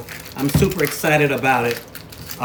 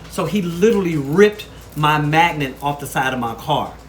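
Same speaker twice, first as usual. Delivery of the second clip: usual speaking voice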